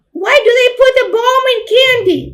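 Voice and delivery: put-on a defiant small child; little girl's voice. Little sister protesting she won't be a person, high child voice. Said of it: "children's voice".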